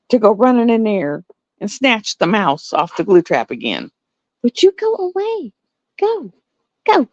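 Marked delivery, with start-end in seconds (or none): 4.4-7.1 high-pitched shooing; shooing away. Acted. Owner chasing cat from the trap room, high-pitched shooing. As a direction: shoo off a pet in a raised pitch.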